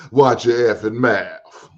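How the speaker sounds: slow, drawn out mock rebuke, each word dragged; slow mock scolding. Host imitating the guest's rebuke, slow and drawn out. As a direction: stretch out "Watch your effing mouth" like a slow warning. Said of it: "She, she said".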